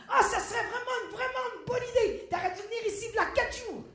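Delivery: high, loud, hammed-up delight; gushing enthusiasm. Journalist wishing he had come days earlier, gushing. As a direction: gush loudly over a great idea.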